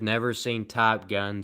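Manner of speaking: ordinary talk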